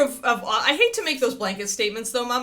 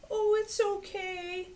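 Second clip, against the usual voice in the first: high, slow, anxious cooing; worried coddling; 13 semitones higher, 3 dB quieter, slower than usual. Retold or imitated. Owner fretting over a scared puppy, high anxious coo. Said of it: "never, ever, ever do the".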